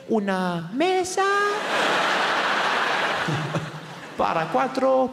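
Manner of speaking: high, slow, proud Spanish; high slow careful Spanish; slow over-enunciated tourist Spanish. Retold or imitated. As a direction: announce it like a hero. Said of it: "I step forward and say".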